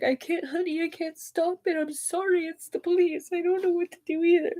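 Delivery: soft, high, apologetic voice; hushed, high-pitched helpless apology; helpless mother. Retold or imitated. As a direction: imitate a mother quietly giving in.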